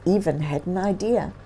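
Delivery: usual speaking voice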